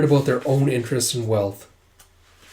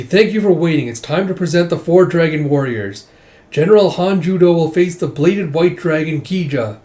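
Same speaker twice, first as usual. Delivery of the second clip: announcer; 3 semitones higher, 4 dB louder than usual. Acted. Announcer presents the dragon warriors.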